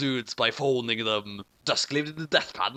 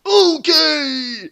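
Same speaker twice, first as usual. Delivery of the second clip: excited; 12 semitones higher, 11 dB louder than usual. A narrator's reading. Brother answers.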